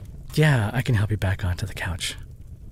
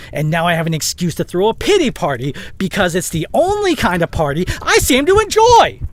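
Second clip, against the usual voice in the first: rising to a loud, high mocking scream; sarcastic quote that builds into a yell; 7 semitones higher, 7 dB louder than usual. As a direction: hurl her words back at her, building to a scream. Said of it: "I'm sorry I yelled".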